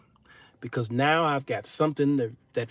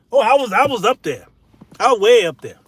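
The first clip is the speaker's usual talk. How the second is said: ordinary talk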